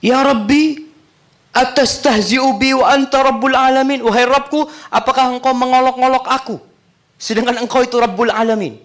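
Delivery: high, loud disbelieving cry; astonished plea. Acted. The man in paradise asking God in high loud astonishment.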